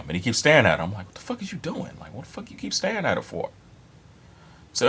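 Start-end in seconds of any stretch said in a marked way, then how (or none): 1.1-3.5 high, hushed disbelief; high-pitched, soft and incredulous; incredulous scolding. Retold or imitated. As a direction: squeak it out under your breath, baffled. Retells his scolding of staring friend.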